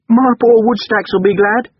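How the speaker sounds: high, loud womanly character voice; servant's wife. A narrator's reading. Coachman's wife remarking to the young master, high and loud. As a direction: chime in loudly and high.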